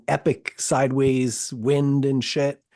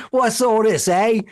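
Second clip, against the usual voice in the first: loud, high poor-Londoner voice; bad Cockney, pitched up and punchy; mock Cockney; 7 semitones higher, 6 dB louder than usual. Joining the British bit as poor person, mock Cockney. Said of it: "So you do the poor person".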